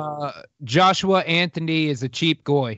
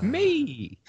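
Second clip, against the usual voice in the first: cheeky high me; 6 semitones higher, 3 dB quieter than usual. Pretend. Joking answer to who do we have.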